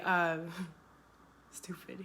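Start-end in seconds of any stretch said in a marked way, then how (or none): 0.9-2.0 breathy laughing whisper. Real, not acted. Laughing at the memory.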